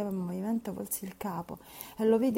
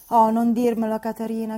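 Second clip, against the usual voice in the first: loud, pleading cry to stop her; urgent loud protest; cried out; 3 semitones higher, 11 dB louder than usual. A narrator's reading. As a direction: call out to cut her off.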